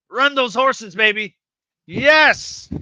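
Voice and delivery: loud, high victory shout; excited cheering. Real, not acted. Streamer cheers a winning moment, excited and loud. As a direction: cheer it on, building to a yell.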